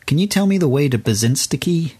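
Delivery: put-on American accent. American tourist asking a local the way in Windsor, mock American accent. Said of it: "That was an American accent".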